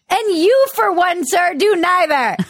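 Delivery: high, slow mock scolding; mock indignation. Comedian telling off Pete Buttigieg, mock indignation. Comic jab after quoting politician.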